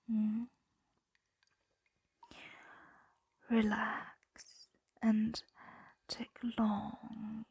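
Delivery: calm ASMR sleep whisper; guided sleep whisper. Acted. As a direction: lull them to sleep, breath by breath. Dragon guides listener to sleep.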